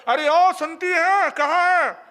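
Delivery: high, loud call to the wife from outside the house; husband calling loudly for his wife from outside. Retold or imitated. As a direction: holler for her from the doorway. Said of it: "क्यों चिल्ला रहे हो?".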